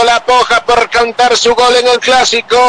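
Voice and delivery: ordinary delivery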